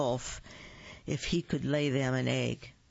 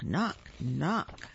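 ordinary delivery, nothing marked

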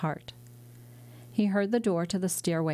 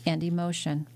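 plain reading voice

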